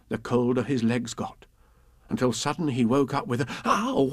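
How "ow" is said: sudden yelp. Acted. Pooh waking with cold legs.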